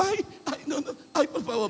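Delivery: quejido muy agudo de burla; diablito lloriqueando. Acted. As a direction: suplica como un diablito acobardado. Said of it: "van a verlo así". (diablito quejumbroso y ridículo)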